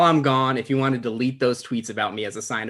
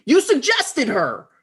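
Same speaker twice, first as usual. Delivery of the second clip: indignant protest; 12 semitones higher, 4 dB louder, slower than usual. Acted.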